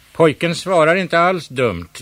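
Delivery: loud approving cry; called out. A narrator's reading. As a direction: shout approval from the tower. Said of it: "ropade gamla Maja".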